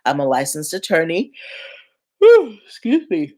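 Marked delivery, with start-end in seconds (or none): 2.2-3.3 real yawn, higher and louder, slow. Real, not acted. Host yawning mid-intro and apologizing to viewers, through a yawn. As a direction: let the words stretch through a yawn. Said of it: "why I'm yawning so much".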